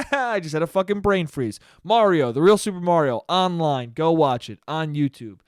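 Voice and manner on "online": ordinary talk, nothing marked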